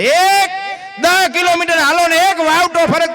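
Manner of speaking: high, loud devotional cry, stretching the name; full-force preaching. Acted. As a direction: call out to the crowd like a bhajan singer. Preacher bursts into full-force singing call.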